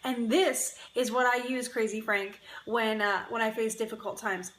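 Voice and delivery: ordinary talk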